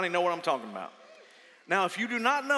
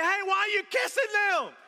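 alarmed outcry; 11 semitones higher than usual. Retold or imitated. Preacher on the plane crying out at the stewardess, high and alarmed. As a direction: shout it out in sudden alarm at the stewardess. Stewardess kissing passengers in turbulence.

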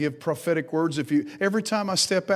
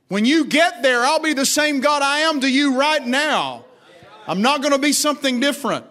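full-force preaching; 10 semitones higher, 5 dB louder than usual. Acted. Preacher to congregation voicing God's I AM, high and loud. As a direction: proclaim God's name with weight.

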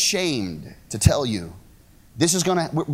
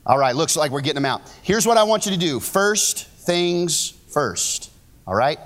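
normal talking voice

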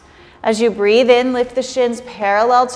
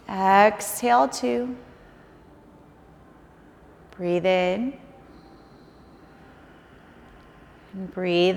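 softer, slow counting of breaths; 3 semitones lower, 6 dB quieter than usual. Acted. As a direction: count quietly, leave long gaps. Yoga teacher counts held breaths.

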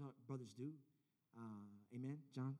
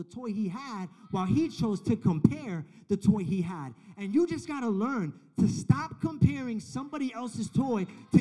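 preaching at full force; 7 semitones higher, 10 dB louder than usual. Acted. Preaching climax.

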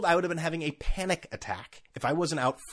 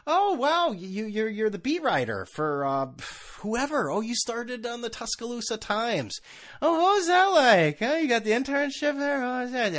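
high, slow fawning voice; mock gushing interviewer; 8 semitones higher than usual. Mocking sports podcasters fawning over a beat writer, high and gushing. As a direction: fawn over the guest in mock awe. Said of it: "I'm done with the".

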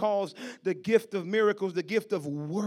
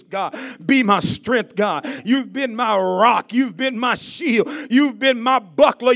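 fervent prayer; 4 semitones higher, 7 dB louder, slower than usual. Real, not acted. Preacher praying aloud to God as rock and shield, building fervour. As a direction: pray it out, each line stronger.

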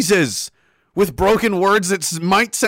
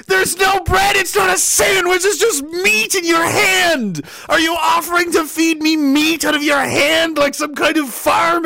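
furious shout; 7 semitones higher than usual. Retold or imitated. Yelling at the drive-thru worker over no bread, furious. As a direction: blow up in disbelief at the clerk.

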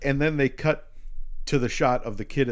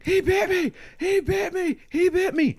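much higher, loud hammed-up scream; 3 dB louder than usual. Bitten teacher thrashing on the gurney, hammed-up scream. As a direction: shriek it like a bitten man thrashing.

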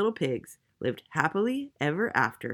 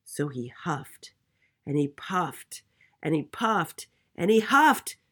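mounting storytelling suspense; building effort; 2 semitones higher, 3 dB louder, slower than usual. A narrator's reading. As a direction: build each phrase from hushed to forceful. Narrator acts out mounting effort.

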